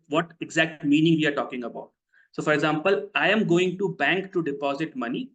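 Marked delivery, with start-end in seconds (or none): none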